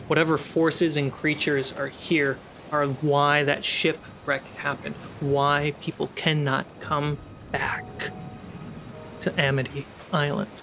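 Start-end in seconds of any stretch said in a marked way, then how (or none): none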